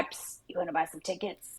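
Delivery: hushed shady scalper voice; secretive raspy sales murmur. Retold or imitated. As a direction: sell it on the sly, quietly. Scalper offering tickets.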